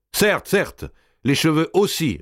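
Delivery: high, lively assent; eager approval. A narrator's reading. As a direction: agree readily, pitched right up. Henchman eagerly approves further shaving. (approbation vive, aiguë)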